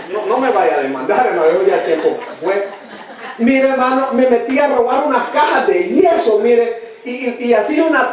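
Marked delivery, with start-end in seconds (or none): 0.1-2.7 chuckling through the words; laughing. Real, not acted. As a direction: joke it off with a laugh. Joking confession of stealing plaster.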